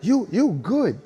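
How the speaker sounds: high, loud, earnest insisting; imitating a Japanese dancer. Japanese dancer telling Link that Henny-san dances better. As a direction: sound sure and innocent while insulting. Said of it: "I'm like".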